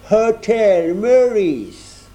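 put-on stiff, solemn Englishman in a thick English accent. A narrator's reading. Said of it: "prit un accent anglais très prononcé et très solennel". (accent anglais très prononcé et solennel, très lent)

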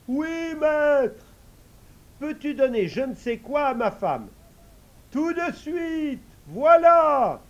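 high, eager magic servant voice; a high airy genie answering; high spirit voice. Acted.

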